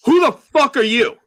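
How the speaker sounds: loud, high confrontational bark; drunkenly aggressive. Retold or imitated. Drunk man confronting a newcomer at the bar door, loud and aggressive. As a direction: imitate a belligerent drunk challenging a stranger. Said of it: "he drunkily and aggressively walks over to me".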